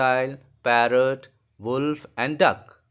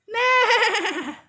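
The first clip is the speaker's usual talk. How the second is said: neigh squealed out very high with a laugh; whinny it like a horse, laughing at yourself; 3 dB louder than usual. Shown as a demonstration. Teacher imitating a horse for the class, high laughing neigh. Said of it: "Like this. ... I'm not very good at making sounds".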